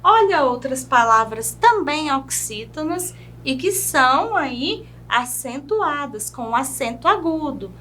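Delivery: normal talking voice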